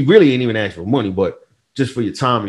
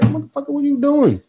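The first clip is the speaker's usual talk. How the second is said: squeaky high indignant yelp; high mock outburst. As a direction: pitch way up, mock-outraged. Quoting a reaction to a friend.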